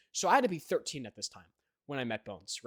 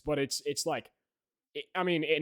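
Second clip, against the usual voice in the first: normal talking voice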